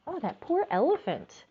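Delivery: usual speaking voice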